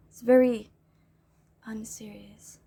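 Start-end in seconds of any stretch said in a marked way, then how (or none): 1.6-2.6 whisper. Real, not acted. Re-reading her own email word.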